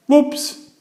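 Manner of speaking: high, cheery whoops; a bright high oops after tumbling; clumsy whoops. A narrator's reading. Clumsy man ends up in a heap on the floor, cheery high whoops.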